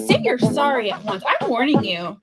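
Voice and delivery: ordinary talk, nothing marked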